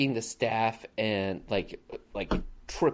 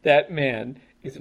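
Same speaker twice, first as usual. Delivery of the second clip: usual speaking voice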